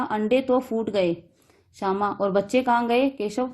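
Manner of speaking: plain reading voice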